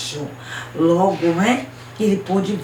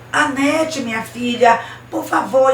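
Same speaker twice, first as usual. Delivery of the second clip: higher, mildly urgent father's plea; pleading father; 6 semitones higher, 4 dB louder than usual. Acted. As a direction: plead with your daughter, voice lifted. Acted father pleading with daughter. (pai suplicando à filha)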